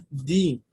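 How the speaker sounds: "di" with a long ee vowel. Shown as a demonstration. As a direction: say "di" clearly, like the word dee. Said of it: "aquí lo estoy pronunciando más como". ("di" pronunciado como "di" con i larga)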